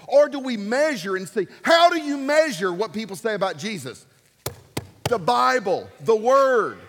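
loud, rising pulpit delivery; preacher hammering on the Word; full-force preaching. Acted. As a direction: drive the point home to the congregation.

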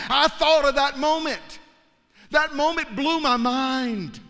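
high, slow, ringing preacher's proclamation; full-force preaching. Acted. Preacher proclaiming forgiveness to the congregation, high and ringing. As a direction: lift it up and testify to the congregation.

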